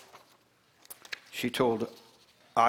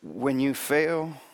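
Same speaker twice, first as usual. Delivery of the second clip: slow gentle reassurance; 3 semitones higher, slower than usual. Real, not acted. Closing encouragement to congregation.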